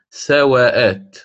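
سواءات with stretched long aa, slow; hypothetical form. Shown as a demonstration. Teacher showing the regular plural pattern. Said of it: "من المفروض أن أقول".

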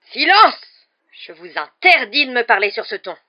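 high explosive shout, then loud forbidding order; enraged yell. A narrator's reading. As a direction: roar the boy into silence. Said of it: "hurla Rogue qui paraissait de plus en plus dément". (hurlement furieux)

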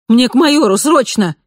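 urgent high shout; blurted out. Acted. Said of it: "выпалила".

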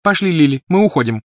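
high, loud, abrupt command; sharp. A narrator's reading. Petunia ordering Lily to leave, sharp. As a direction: order your sister away sharply. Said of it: "резко бросила она".

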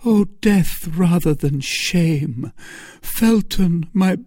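high, drawn-out imploring lady; desperate entreaty. A narrator's reading. Milady implores Felton as brother and friend, high and desperate. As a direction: implore him dramatically, dragging out the last plea.

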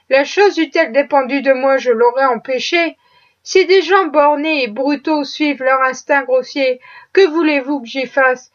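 plain reading voice

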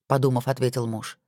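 ordinary delivery, nothing marked

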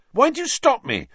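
high, loud angry protest. A narrator's reading. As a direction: snap it out angrily. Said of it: "said Ron angrily".

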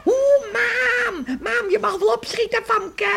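laughing call to a friend outside. Acted. Girl calls laughing to Famke across the yard.

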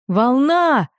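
high, loud warning yell; shrill cry of alarm; piercing shout. Acted. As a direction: scream it to warn the whole village. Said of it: "пронзительно закричал кто-то".

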